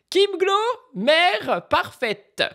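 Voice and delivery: high, drawn-out mock praise; very slow, high, ironic announcement; sarcastic headline. Real, not acted. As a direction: drag it out with irony.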